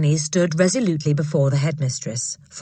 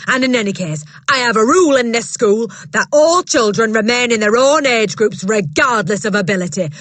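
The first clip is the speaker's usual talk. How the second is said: loud, bullying headmistress voice, higher; booming headmistress; 5 semitones higher, 6 dB louder than usual. Acted. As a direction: bark it down at a timid teacher. Trunchbull voiced in story.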